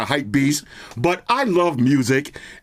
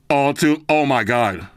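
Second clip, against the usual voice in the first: ordinary talk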